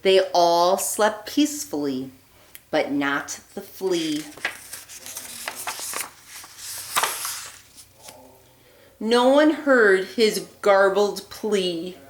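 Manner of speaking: put-on lively read-aloud voice for children; storybook reading. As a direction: read it like a bedtime story for little ones. Reads picture book aloud.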